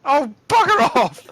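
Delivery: high, loud exasperated yell; real frustrated shout at bad luck; exasperated outburst. Real, not acted. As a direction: shout it out in sudden disbelief. Exasperated reaction to bad roll.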